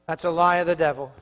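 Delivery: ordinary talk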